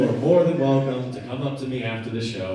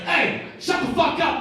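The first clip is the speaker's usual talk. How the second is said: much higher yell to cut someone off; mock angry shout; 11 semitones higher than usual. Passenger standing up for his Uber driver, mock shout. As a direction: shout it out the car window. Comic retells yelling from car window.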